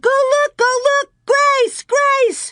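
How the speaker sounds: very high, loud repeated screams; panicked screaming. A narrator's reading. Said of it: "Carrie screamed".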